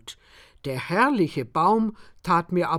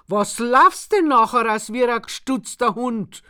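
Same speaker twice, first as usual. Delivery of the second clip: higher, louder angry question; 6 semitones higher, 7 dB louder than usual. A narrator's reading. Narrator angrily questions the farmer, high and loud. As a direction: demand an answer angrily. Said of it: "fragte ich wütend".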